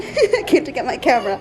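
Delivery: laughing through the words; amused chuckle while explaining. Real, not acted. To viewers while her crying daughter wails, laughing.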